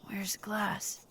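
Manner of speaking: hushed whisper so no one wakes. Acted. Whispering so sleepers in the car stay asleep. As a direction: breathe it out under your breath.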